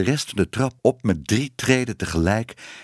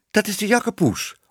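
high-pitched cry of recognition; delighted exclamation; 12 semitones higher than usual. A narrator's reading. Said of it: "riepen Tibbe en Minoes". (hoge, verraste uitroep)

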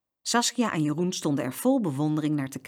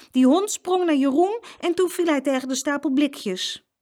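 put-on high, emphatic child's account; child explaining; 8 semitones higher, 4 dB louder than usual. A narrator's reading. Saskia tells mama about the dog, high and urgent.

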